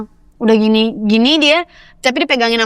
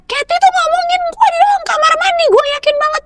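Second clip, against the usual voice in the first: much higher mock yell of an accusation; hammed-up angry accusation, pitched way up; retold yelling. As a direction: imitate her yelling at everyone in the toilet. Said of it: "Dia teriak-teriak".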